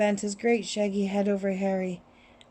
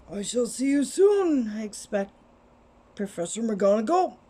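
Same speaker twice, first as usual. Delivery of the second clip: put-on wise old wizard voice. Headmaster bids farewell.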